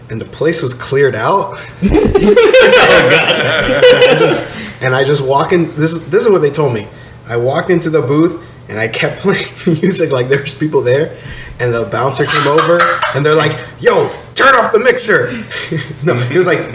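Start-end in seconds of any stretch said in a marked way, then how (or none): 1.9-4.1 squealing laugh, much higher; high burst of laughter. Real, not acted. Laughing at his own story. 13.8-15.4 a hint of a stern bouncer, higher. Bouncer voiced in story.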